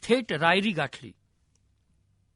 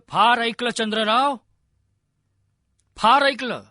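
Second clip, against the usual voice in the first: loud, raised, impassioned threat; fierce fury; 5 dB louder than usual. A narrator's reading. Envoy warns the lord of his doom, fierce and loud. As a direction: deliver each phrase forcefully, with weighty pauses. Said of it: "त्वेषाने Raghunath Pant बोलले".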